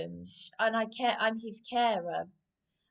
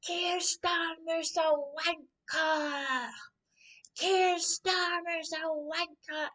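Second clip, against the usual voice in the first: slow, drawn-out, higher mock chant; imitating a bellow; 7 semitones higher, much slower than usual. Retelling a Scottish protester bellowing, mock chant. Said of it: "She bellowed".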